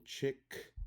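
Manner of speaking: "chick" punched high and alone; stressed syllable. Shown as a demonstration. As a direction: hit "chick" as the strong syllable. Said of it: "has the stressed syllable".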